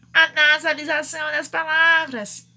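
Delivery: A NASALIZAÇÃO DAS PALAVRAS loud and drawn through the nose; nasalized. Shown as a demonstration. Teacher illustrating the nasal accent, exaggeratedly nasalized. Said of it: "é o acento nasal".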